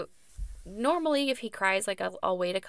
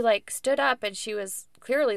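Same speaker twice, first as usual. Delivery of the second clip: normal talking voice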